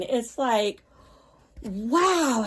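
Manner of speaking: ordinary talk, nothing marked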